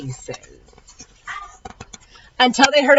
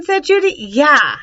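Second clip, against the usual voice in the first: ordinary delivery, nothing marked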